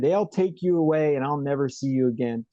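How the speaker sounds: ordinary delivery, nothing marked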